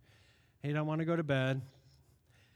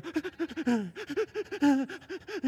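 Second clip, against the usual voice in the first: gasps; 9 semitones higher than usual. Retold or imitated. Imitating baby's sobbing gasps.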